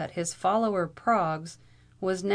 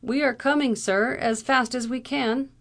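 high, raised-voice reply; exclaiming; 6 semitones higher, 5 dB louder than usual. A narrator's reading. Lawyer answering the angry captain across the field, exclaiming. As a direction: call back higher and louder. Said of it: "exclaimed Howard".

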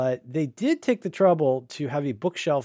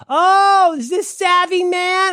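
much higher, louder, slow, chirpy put-on receptionist voice; high mock receptionist; 9 dB louder, much slower than usual. Mocking the vet receptionist who mangles his name, in a high put-on voice. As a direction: squeal it like an overeager front desk.

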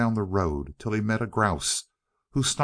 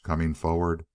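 usual speaking voice

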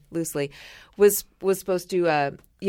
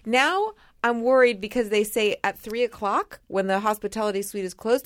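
ordinary talk, nothing marked

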